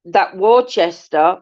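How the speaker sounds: usual speaking voice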